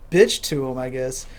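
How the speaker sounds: usual speaking voice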